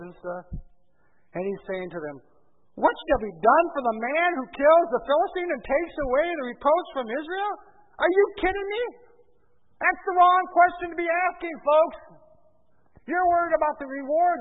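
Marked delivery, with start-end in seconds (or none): 2.8-14.4 high, loud indignant disbelief; incredulous challenge. Acted. David scolding the men fixated on the reward, indignant. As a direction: challenge them as if they've missed the point. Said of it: "this is how he said it to them".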